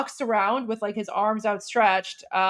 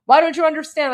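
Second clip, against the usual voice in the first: higher pitched mock shout, repeating the question; imitated exasperated yell; 5 semitones higher, 4 dB louder than usual. Activist shouting at the leftist woman, mock exasperated yell. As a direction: hammer the question home, fed up. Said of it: "he's so frustrated".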